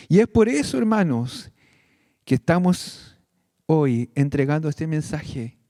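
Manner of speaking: ordinary talk, nothing marked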